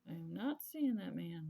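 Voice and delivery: loud and high, firmly denying it; indignant denial. Retold or imitated. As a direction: shut the gossip down hard. Denying a rumour about dating.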